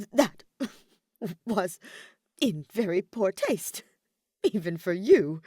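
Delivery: scolding while a laugh slips out; stifled laugh. A narrator's reading. Ferdinand scolding Hubert while stifling a laugh. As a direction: fight back a giggle as you scold. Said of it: "trying not to laugh".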